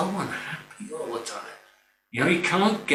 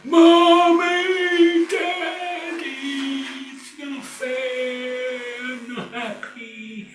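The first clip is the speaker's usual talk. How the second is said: put-on very high, slow child's wail that fades; whining child; 12 semitones higher, much slower than usual. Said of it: "And they're crying".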